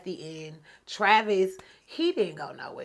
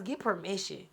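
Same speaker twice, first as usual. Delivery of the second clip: ordinary delivery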